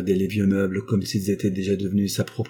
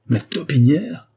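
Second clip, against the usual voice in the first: slow, louder, higher outraged echo; indignant outcry; 6 semitones higher, 5 dB louder, slower than usual. Acted. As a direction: hurl the word back, offended. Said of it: "s'écria la vieille fille, pâle de colère". (la vieille fille s'écrie, pâle de colère, plus fort et lentement)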